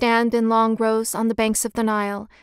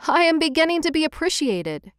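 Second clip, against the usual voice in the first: put-on higher-pitched cry of a cheered young writer; a lifted, happy outburst at normal volume; delighted cry; 6 semitones higher than usual. A narrator's reading. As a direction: mildly exclaim with pleased surprise. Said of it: "he cried".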